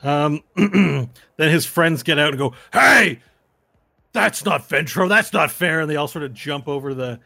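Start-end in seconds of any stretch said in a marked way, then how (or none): 2.7-5.8 high, loud shouted objection; angry protest. Acted. Fighter's friends protesting to the crowd, high loud angry shout. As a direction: shout foul at the ring.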